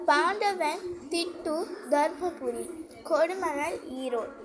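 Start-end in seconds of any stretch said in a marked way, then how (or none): none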